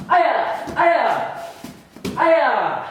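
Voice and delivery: three high, sharp martial arts yells; kiai shouts. Shown as a demonstration. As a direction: snap each aya out with the strike.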